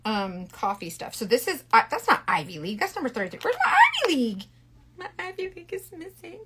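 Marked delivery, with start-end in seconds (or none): 3.4-6.4 playful dismay. Real, not acted. Searching for a missing shade.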